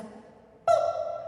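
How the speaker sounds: tiny high unvoiced boop; quick boop. Shown as a demonstration. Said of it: "That's how fast it is".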